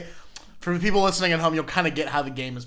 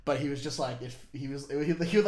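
usual speaking voice

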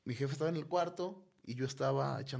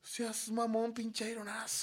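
regaño burlón; 10 semitones higher, slower than usual. Retold or imitated. Scolding the ghost as wind.

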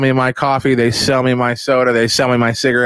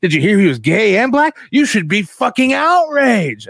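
high, hammed-up hype man; mock outrage merchant, high and pushy; hyped-up gossip; 12 semitones higher than usual. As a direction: egg the listener on, way up in pitch. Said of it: "somebody's like".

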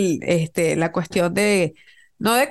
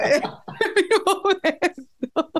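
slow higher words through laughter; laughing; 7 semitones higher, much slower than usual. Real, not acted. Gloating at in-laws' mistake, slow laughing. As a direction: savor it while laughing.

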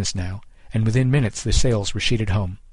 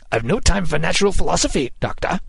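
raised, higher-pitched captain's retort; shouting back; 6 semitones higher than usual. A narrator's reading. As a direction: shout back over the gale. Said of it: "Kidd shouted back".

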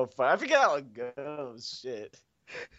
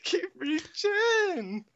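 high, slow, laughing through the words; 12 semitones higher, much slower than usual. Real, not acted. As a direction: gasp it out while cracking up. Laughing fit while singing.

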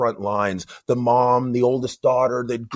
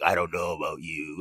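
imitated suspicion; 5 semitones lower, 6 dB quieter than usual. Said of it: "giving you that sideways look".

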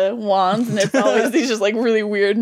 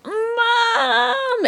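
long high wail for mom; hammed-up frantic cry, pitched way up; mock freaking out; 14 semitones higher than usual. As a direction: play a panicked kid calling out, drawn out. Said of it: "I would start freaking out".

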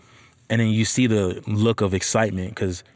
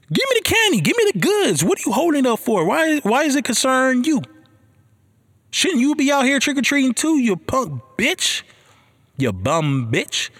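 mock angry rant; 11 semitones higher than usual. Ranting at judgy homeowner.

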